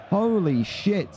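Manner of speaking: usual speaking voice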